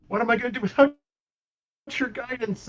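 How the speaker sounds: lifted high in pitch, grief pushing each phrase; a girl pleading with her lost protector, half-crying; acted sobbing plea. Gayla grieving over Lily, pleading through acted sobs. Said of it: "just starts sobbing".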